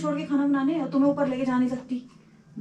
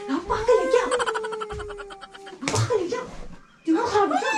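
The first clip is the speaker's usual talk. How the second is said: higher, laughing in disbelief; exasperated scolding; 8 semitones higher than usual. Real, not acted. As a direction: scold him, half laughing.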